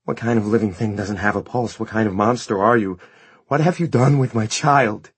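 horrified accusation. Acted. Mother confronting her vampire son, horrified and accusing. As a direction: build to an anguished outcry.

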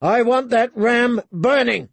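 high, forceful battle order; shouting. A narrator's reading. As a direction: shout the demand to the archers. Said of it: "he shouted".

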